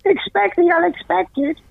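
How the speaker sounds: be a squeezed toy saying its catchphrase; squeaky toy voice. Pretend. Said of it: "You'd squeak it and go".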